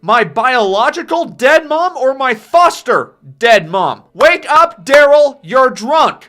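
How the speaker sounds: high, loud furious yelling. Retold or imitated. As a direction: scream it at a drunk father. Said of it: "Barry is now yelling".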